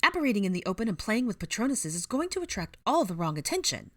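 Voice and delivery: put-on high, fussy old woman's telling-off; exasperated high-pitched lecture; scolding. A narrator's reading. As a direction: scold them for being careless. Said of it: "she scolded".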